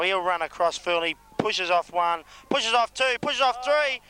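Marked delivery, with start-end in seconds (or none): 2.5-4.0 rising commentary, much higher and building; excited call. Acted. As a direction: call it like the try is coming, pitch climbing. Commentator calls a near try.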